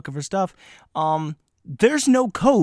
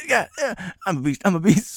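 cocky; 6 semitones higher than usual. Retold or imitated. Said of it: "And I was like".

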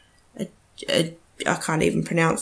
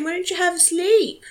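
coaxing the dog; 12 semitones higher than usual. Real, not acted.

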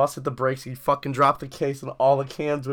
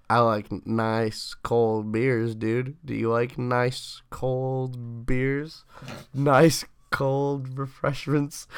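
a put-on, lingering beer-salesman tease; 3 dB quieter, much slower than usual. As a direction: stretch every word out slowly to mess with him. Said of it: "saying that in that voice".

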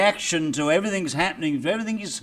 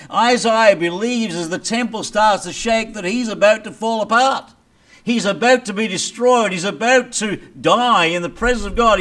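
raised, intense preacher's build; higher and louder full-force preaching; fervent preaching; 4 semitones higher, 4 dB louder than usual. Acted. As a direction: drive the point home from the pulpit. Climax of the sermon.

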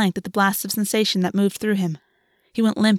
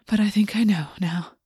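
whisper with a smile. A narrator's reading. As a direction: whisper it, smiling. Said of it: "a smile in his voice".

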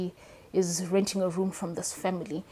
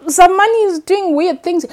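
much higher and louder, an amazed exclamation; surprised realization; 10 semitones higher, 13 dB louder, slower than usual. Retold or imitated. Author recalling her surprise while reading, higher and louder. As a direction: throw your voice up in astonishment. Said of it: "and then I'm like".

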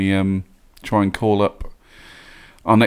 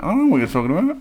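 baffled student; 11 semitones higher, much faster than usual. Retold or imitated. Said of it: "I'm like".

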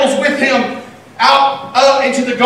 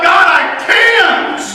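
whining to God; 6 semitones higher, much slower than usual. Retold or imitated. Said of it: "you're like".

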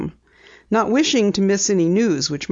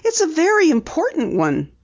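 higher, earnest insistence; insisting; 10 semitones higher than usual. A narrator's reading. Penny pressing Missus Weems about the clock, insistent. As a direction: press the point, lightly voiced. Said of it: "Penny insisted".

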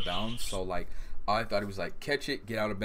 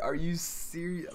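incredulous; 8 semitones higher, slower than usual. Retold or imitated. Reacting to sideline run.

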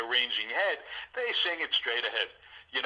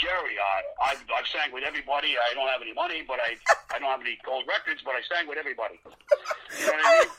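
Alex Augustine impression. [name] imitates Alex Augustine for the host, in Alex's voice. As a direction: do Alex's voice, with the last line jumping way up. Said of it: "that's how he talks".

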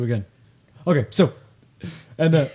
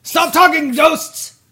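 loud, very high-pitched yell; exasperated shout; 12 semitones higher, 7 dB louder, much slower than usual. Pretend. Interviewer silencing ghosts during the pitch, exasperated shout. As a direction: holler it at the hecklers.

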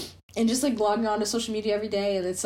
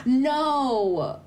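shocked gasp; 7 semitones higher than usual. Real, not acted. Shocked friend hasn't heard it.

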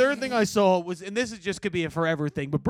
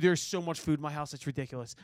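ordinary delivery

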